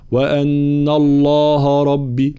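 الله with a thick ه, slow and drawn out; heavy ha mistake. Shown as a demonstration. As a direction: darken the ه in الله on purpose. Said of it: "يقرأ الهاء بالتفخيم".

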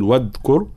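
"واذكر" with the ذ stopped like a d; wrong hard dhal. Shown as a demonstration. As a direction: show the mistake: say "واذكر" as wadkur. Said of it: "فلا نقول".

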